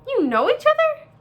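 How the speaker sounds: high, loud astonished question; surprised gosling. A narrator's reading. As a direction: blurt it out as a startled young gosling.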